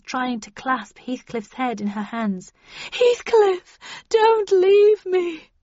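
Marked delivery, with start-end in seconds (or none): 2.9-5.5 high, loud, slow anguished plea; desperate plea. A narrator's reading. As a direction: plead as if losing him. Catherine clinging to Heathcliff.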